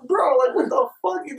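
high-pitched retold "bro"; incredulous, pitched well up; exasperated disbelief. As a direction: protest in mock shock. Retold exasperation at drunk friend.

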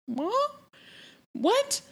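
very high, questioning squeak; mock surprise. She hears him pull back, high mock-baffled question. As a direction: imitate someone taken aback, voice shooting up. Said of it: "And you can see her go".

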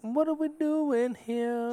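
high, slow and stretched out; drawn-out high voice. Pretend. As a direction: drag the question out high and slow. Repeats the question, drawn out.

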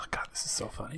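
dry whispered aside; whispering. Real, not acted. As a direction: breathe it out under your breath. Whispered aside during bit.

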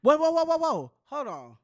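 high, loud run of whoas; startled interruption. Real, not acted. Host stopping co-host mid-explanation, startled interruption. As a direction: cut in loudly to halt them.

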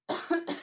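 sudden sharp cough; real cough breaking the talk; coughing fit. Real, not acted. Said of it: "Sorry, I'm gonna cough".

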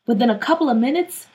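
ordinary delivery

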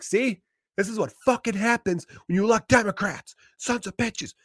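high, whiny mock outrage; mock a paranoid neighbor griping; high-pitched mock griping neighbor; ranting neighbor. Angry neighbor ranting online.

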